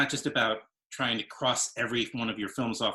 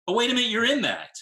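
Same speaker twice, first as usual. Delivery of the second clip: sudden realisation; 12 semitones higher, 6 dB louder, faster than usual. Real, not acted. Realises guest acted in show.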